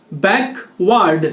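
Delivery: बैकवर्ड drawn out slowly, every syllable clear; slow spelled-out backward. Shown as a demonstration. Teacher slowly modelling बैकवर्ड for students. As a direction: stretch बैकवर्ड out slowly for the class. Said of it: "इसको पढ़ते हैं बैकवर्ड".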